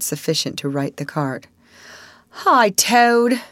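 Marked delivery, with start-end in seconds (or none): 2.4-3.4 loud, high call across the road. A narrator's reading. Rat and Mole calling Toad to lend a hand, loud. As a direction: call him over to help. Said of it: "they cried".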